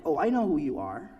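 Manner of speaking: usual speaking voice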